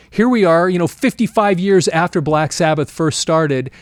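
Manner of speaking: ordinary talk, nothing marked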